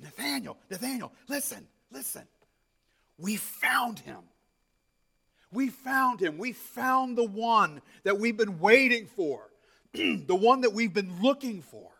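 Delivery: high, eager, out of breath; breathless excitement. Acted. Philip telling Nathanael they found the Messiah, breathless and excited. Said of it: "you can see this excitement in Philip's voice".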